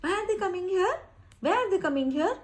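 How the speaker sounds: high, wondering ask; puzzled question. Shown as a demonstration. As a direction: ask as if unsure why they come. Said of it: "पूछते हैं ना". (ऊँची आवाज़ में हैरानी भरा सवाल)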